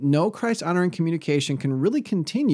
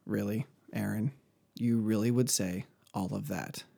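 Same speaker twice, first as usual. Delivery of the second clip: mock incredulous, quietly asking; a quiet, skeptical really; doubtful listener; 5 semitones lower, 7 dB quieter than usual. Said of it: "you may be thinking".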